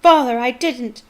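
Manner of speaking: high desperate shriek; a terrified son shrieking his innocence; high, loud begging cry. Acted. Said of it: "shrieked the boy".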